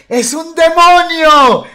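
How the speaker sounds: very high, loud, long-held mock scream; shrieking accusing mother. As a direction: howl the accusation like an outraged mom. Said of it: "Y ella decía". (chillido acusador de madre, exagerado)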